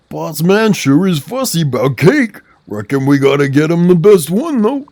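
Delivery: lightly voiced country sidekick; folksy character voice. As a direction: read it like a folksy helper. Voicing a folksy game character.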